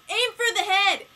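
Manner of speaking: high, loud urgent shout; full-voiced shout at a book; re-enacted yell. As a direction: yell it out like a command. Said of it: "I'm yelling in the middle of class".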